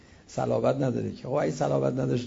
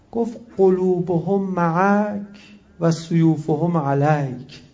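each word drawn out and pressed louder; slow solemn quote; 3 semitones higher, 6 dB louder, much slower than usual. Retold or imitated. Preacher quoting the Kufan messenger, slow and heavy. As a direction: intone the Arabic saying weightily.

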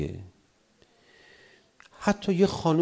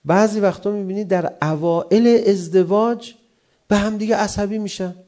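normal talking voice

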